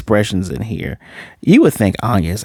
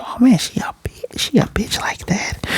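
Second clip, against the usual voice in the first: hushed, higher aside; whispered thought; 12 semitones higher, 4 dB quieter than usual. Retold or imitated. Reviewer privately judging the wife, whispering. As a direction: mutter it under your breath. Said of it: "I was thinking to myself".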